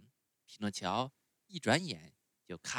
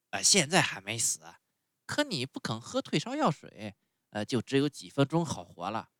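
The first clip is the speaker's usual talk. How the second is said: louder, ominous rabbit voice; grim rabbit; 3 semitones higher, 7 dB louder than usual. A narrator's reading. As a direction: warn him darkly like an undertaker. Rabbit speaking.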